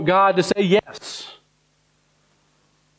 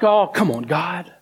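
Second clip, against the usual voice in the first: raised, pushy protest to God, a touch mocking; higher mock gripe of "come on, God"; indignant complaining; 6 semitones higher than usual. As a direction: play the churchgoer who feels owed. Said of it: "And then we're like".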